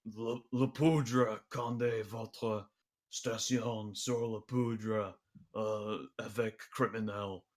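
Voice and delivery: slow, gruff New York worker mangling French; gruff New York accent. Acted. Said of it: "in this kind of very gruff, uh, workers' New York accent".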